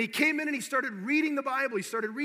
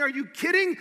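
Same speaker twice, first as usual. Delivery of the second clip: louder, rising preacher's cry; fervent preaching; 4 semitones higher, 6 dB louder than usual. Acted. Preacher rousing the congregation, loud and fervent. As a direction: preach it with fire.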